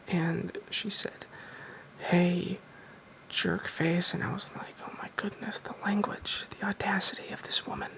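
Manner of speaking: hushed whisper for a Q and A video; soft-spoken whisper. Real, not acted. Host whispers his Q and A to viewers, soft-spoken. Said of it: "I'm doing soft-spoken in this video".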